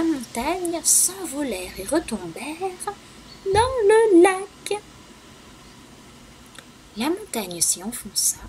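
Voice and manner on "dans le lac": high, loud and slow; dramatic splash. A narrator's reading. Storyteller making the children feel the splash, high and loud. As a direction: land it big, like a splash.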